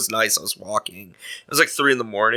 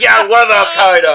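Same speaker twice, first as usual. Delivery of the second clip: higher, louder mock defiance; imitated angry comeback; 8 semitones higher, 8 dB louder than usual. Angry woman answering her heckler, imitated loud defiance. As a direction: throw it back loudly, imitating her.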